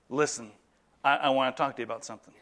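soft, low and caring; loving approach. Acted. Coming alongside family to talk, lovingly and softly. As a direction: open gently, then softly hurry the rest. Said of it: "and lovingly say".

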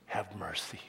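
whispered scripture words; hushed reverent whisper. Acted. Reading the verse in a whisper.

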